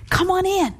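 bright rise in pitch, inviting call; welcoming. Acted. Host welcoming someone into her home, welcoming. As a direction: welcome a guest in with a high, open lilt. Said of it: "to have a welcoming heart".

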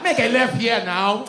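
put-on loud, high, slow African-accented order; angry African GPS accent. African GPS losing patience with driver, loud African accent. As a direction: drive the command home, exasperated African accent. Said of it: "Nigerian on a G P S".

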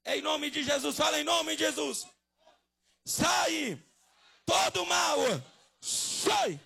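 high, full-force shouted commands; exorcism shout. Real, not acted. Preacher casting evil out in Jesus' name, shouting. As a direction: order the evil out at the top of your voice.

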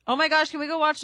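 ordinary talk

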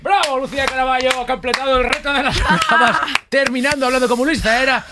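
high excited show-host call; announcer cheer. Acted. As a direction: hype the winner like a TV host.

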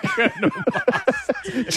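high, slow, laughing "Colonel Mustards". Real, not acted. Host laughing helplessly at the odd name. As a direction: crack up while saying "Colonel Mustards".

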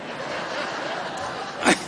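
real burst of breathy laughter. Real, not acted. Laughing at his own joke about the drivers. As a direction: laugh at your own punchline.

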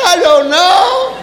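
put-on slow, drawn-out child's whine; whiny child voice. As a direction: drag it out like a clueless kid.

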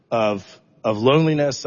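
ordinary talk, nothing marked

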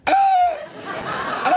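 sudden gulping yelps; exaggerated hiccup noises; imitated hiccup. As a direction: mimic the daughter catching hiccups. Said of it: "de repente empieza ella".

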